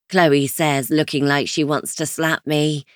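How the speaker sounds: plain reading voice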